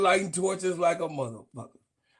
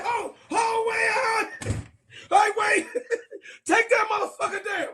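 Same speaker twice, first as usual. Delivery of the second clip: mock panic; 14 semitones higher than usual. Streamer mocking the voting officials, high panicked shouting. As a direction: squeal it like a flustered official.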